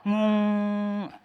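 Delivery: mock buzzing hum, held; bee buzz. Preacher mimics a bee's buzz for the church. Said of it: "itu suara".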